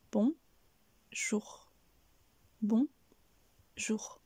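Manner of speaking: BON, pause, JOUR, each syllable on its own; split syllables, repeated. Shown as a demonstration. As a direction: drill bon ... jour with clear gaps. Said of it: "en découpant le mot".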